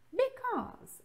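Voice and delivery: "because" high, falling on the stressed syllable; second intonation of because. Shown as a demonstration. Teacher shows students the intonation options, high falling "because". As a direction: pitch "because" up high, then let it fall. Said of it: "com essas duas entonações".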